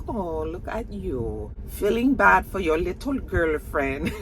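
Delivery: hammed-up foreign accent, slow opening; drawn-out taunt in a badly faked accent; [name]'s put-on accent. Woman teases him about his girlfriend, mock accent. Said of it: "That's my accent".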